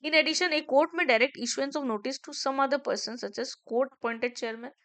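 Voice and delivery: slow, loud, deliberate recital of legal text; reading the law aloud. Acted. Teacher reading the statute out to students, slow and loud. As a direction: read each clause out slowly for dictation.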